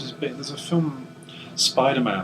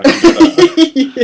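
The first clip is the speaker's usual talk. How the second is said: much higher, slow, loud squeak; high mock falsetto; 12 dB louder, slower than usual. Mocking the vampire who humours her, falsetto. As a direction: squeak it out sweetly.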